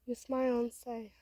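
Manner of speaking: ordinary delivery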